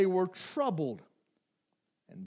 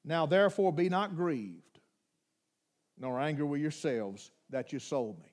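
softer and lower, gentle; tender reassurance; 7 semitones lower, 7 dB quieter than usual. Acted. Joseph reassuring his guilty brothers, tender and soft. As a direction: comfort them quietly.

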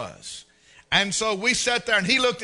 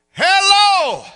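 much higher, much louder mock yell of a greeting; shouted hello; 12 semitones higher, 13 dB louder than usual. A boy yells hello at the missionary, mock loud. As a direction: bellow a hello at the stranger. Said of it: "Real loud".

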